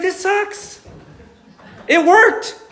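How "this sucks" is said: sulky Jonah. Retold or imitated. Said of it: "He's like".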